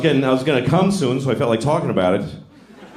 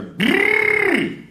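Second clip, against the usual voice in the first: crazy person noise. Retold or imitated. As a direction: blurt a wild high brrr. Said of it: "I hear this".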